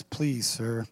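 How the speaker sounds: low, soft, meek please sir; timid please. Shown as a demonstration. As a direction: say it shyly. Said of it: "See the difference?".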